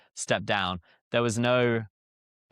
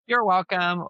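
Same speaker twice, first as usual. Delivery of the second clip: higher, louder American courtesy; imitated American; 8 semitones higher, 5 dB louder, slower than usual. Brit mimicking what Americans say after a thank you, imitated American. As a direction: chirp it like an American. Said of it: "Whereas in America, you say".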